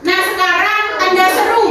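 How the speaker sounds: ordinary delivery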